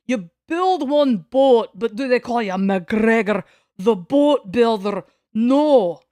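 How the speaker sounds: loud, slow hammed-up Scottish accent. Streamer telling the McGregor joke in a loud Scottish accent. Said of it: "like, with a Scottish accent".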